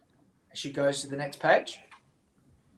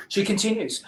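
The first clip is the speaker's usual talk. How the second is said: ordinary delivery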